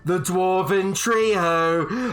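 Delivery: high, loud, slow drawn-out announcing; pub announcer. Acted. Gnome host introducing contestants, loud showman call. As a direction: hype the crowd like a ringmaster. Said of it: "It's like".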